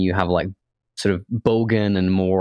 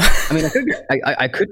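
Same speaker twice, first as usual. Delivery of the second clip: laughing; 7 semitones higher, 4 dB louder than usual. Real, not acted. Laughing at the drinking joke.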